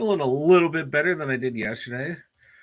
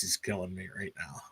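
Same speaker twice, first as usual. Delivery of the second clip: whisper; 4 semitones lower, 11 dB quieter than usual. Real, not acted. Sick cohost complaining.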